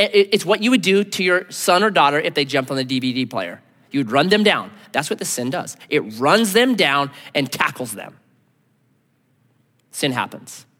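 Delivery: normal talking voice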